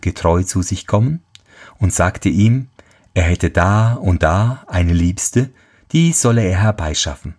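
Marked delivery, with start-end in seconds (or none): none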